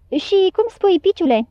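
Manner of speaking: ordinary delivery, nothing marked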